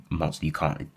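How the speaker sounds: ordinary delivery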